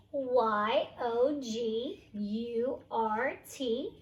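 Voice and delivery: slow, letter by letter; spelling slowly. Shown as a demonstration. Teacher spells story words, slow and clear. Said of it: "teacher spells word slowly".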